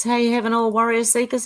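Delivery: ordinary delivery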